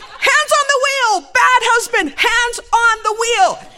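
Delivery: high, slow full shout; alarmed scolding. Retold or imitated. Wife scolds her driving husband, loud high shout. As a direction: yell the order, stretching each word.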